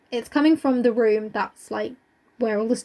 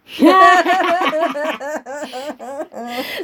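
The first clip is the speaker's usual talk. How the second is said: long rising laugh, a bit louder; burst of laughter; 3 semitones higher, 6 dB louder than usual. Real, not acted. Laughing at her co-host's Mars bar joke, long rising laugh. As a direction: let a real laugh roll out.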